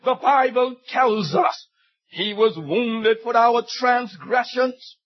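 normal talking voice

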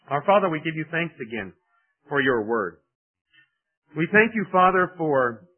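earnest closing prayer to the Father. Acted. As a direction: pray it sincerely, lifting the thanks.

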